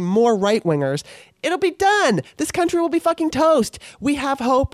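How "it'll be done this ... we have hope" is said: heated outburst about the country's future; impassioned rant; worked up. Real, not acted. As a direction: pitch jumps way up, fired up. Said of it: "I'm so spirited, aren't I?".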